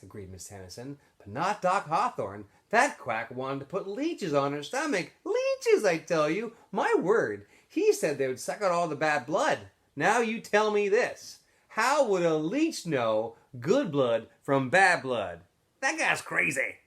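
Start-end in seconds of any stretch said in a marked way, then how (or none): 1.2-5.1 a touch of huffy scorn, slowing down to make her point; lightly voiced, scandalised townswoman; indignant old lady. Miss Tennyson scolds about the doctor. 5.2-5.8 leeches shrieked way up high in disbelief; outraged exclamation. Acted. 5.8-16.8 a touch of huffy scorn, slowing down to make her point; lightly voiced, scandalised townswoman; indignant old lady. Miss Tennyson scolds about the doctor.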